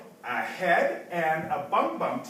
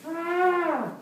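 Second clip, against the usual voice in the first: higher, less forceful roar; high drawn-out animal cry; 9 semitones higher than usual. Acted. Storyteller showing children another jungle sound, high roar. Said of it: "They didn't say".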